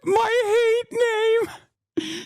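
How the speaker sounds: very high, very slow stretched mock quote. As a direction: drag her phrase out in a squeal. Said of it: "she said".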